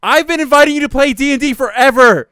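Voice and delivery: loud, high, laughing mock anger; hammed-up aggrieved rant; mad complaint. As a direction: whine it like a hurt friend left out. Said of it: "so Tommy is mad".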